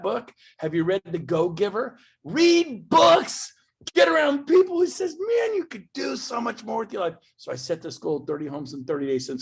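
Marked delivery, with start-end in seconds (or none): 2.2-7.2 impassioned cry. Real, not acted. Passionate plea to audience.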